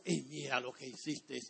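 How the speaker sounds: a hint of the devil mocking a believer; the devil accusing. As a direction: taunt the sinner as the tempter turned accuser. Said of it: "el mismo ... ahora te acusa".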